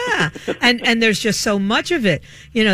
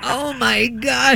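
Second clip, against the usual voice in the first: exasperated; 4 semitones higher, much slower than usual. Retold or imitated. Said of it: "And I'm going".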